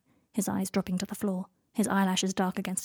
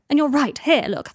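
higher, louder, exasperated; heated retort; 6 semitones higher, 7 dB louder than usual. Acted. Draco snapping at Harry in a quarrel, heated retort. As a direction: fire it back in a quarrel. Quarrel between the two characters.

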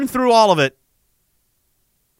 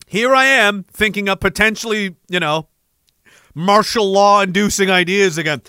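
normal talking voice

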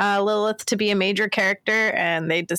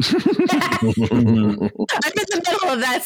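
laughing; 4 semitones higher, 3 dB louder, much slower than usual. Real, not acted. Laughing while talking.

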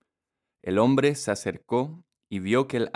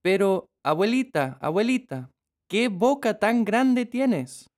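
put-on amazed little girl; 11 semitones higher than usual. A narrator's reading. Girl asking the last question. (niña asombrada)

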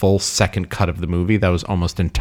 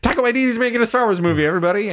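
high, fast mock studio-head announcement; 13 semitones higher, faster than usual. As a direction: announce it brightly and fast to everyone, hammed-up. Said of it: "then she's like".

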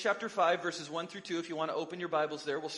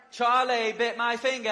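put-on higher, louder quote in a British child's accent; a quick mock British accent, higher and louder; British kid accent; 5 semitones higher, 7 dB louder than usual. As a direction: imitate the British boy from the viral video. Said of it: "You're getting all the accents today".

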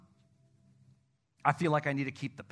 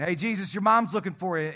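lightly acted, raised-voice call to someone; 4 semitones higher, 7 dB louder than usual. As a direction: call over to pass on a message. Disciple calling to Jesus.

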